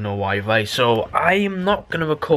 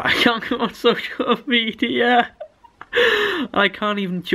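laughing through the complaint, pitched higher; can't help cracking up mid-rant; 10 semitones higher than usual. Real, not acted. Vlogger laughing at his lost Wi-Fi, laughing.

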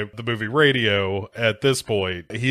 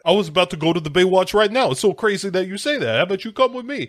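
Brian impression; 7 semitones higher, faster than usual. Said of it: "impression of Justin Bartha as Brian".

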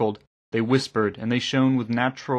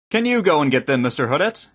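raised, louder request; projected, higher-pitched asking; calling down; 5 semitones higher, 5 dB louder than usual. A narrator's reading. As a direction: call down from the deck. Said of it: "he called down".

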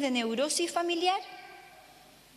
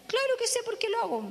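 put-on higher, knowing child's voice; 8 semitones higher than usual. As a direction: play the cheeky nine-year-old. Boy voiced in retold dialogue.

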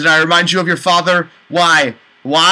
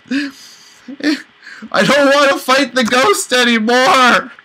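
whiny pleading; 9 semitones higher, 3 dB louder, slower than usual. Real, not acted. Player begs not to fight.

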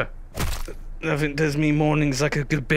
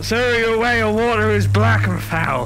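loud complaint; 7 semitones higher, 5 dB louder than usual. Acted. Complaining to a game character.

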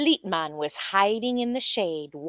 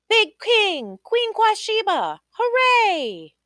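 high, louder joyful cheer; cheering crowd; 13 semitones higher, 5 dB louder, slower than usual. Acted. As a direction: shout it like the whole kingdom cheers.

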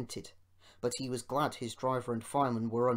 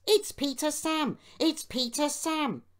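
high, loud wail of alarm; high-pitched panicky cry; 14 semitones higher, 6 dB louder than usual. A narrator's reading. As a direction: wail a desperate warning. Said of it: "wailed the empty trucks".

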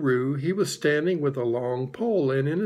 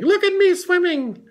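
high, loud little squeak; Roo; 6 dB louder than usual. A narrator's reading. Roo shows off from the pool, squeaking. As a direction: squeak it out to show off. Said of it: "squeaked Roo".

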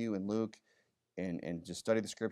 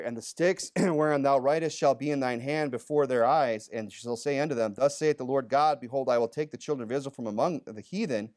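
louder, declaiming reading of the King James text; scripture reading; 3 semitones higher, 5 dB louder than usual. Acted. Pastor reading Jeremiah to his church, declaiming. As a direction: proclaim the verse to the room. Reads Ezekiel aloud.

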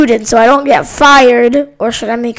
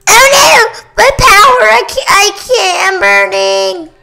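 higher, louder hammed-up panic; mock panic; 7 semitones higher, 4 dB louder than usual. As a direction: cry out as if you're on fire. Character burning, losing powers.

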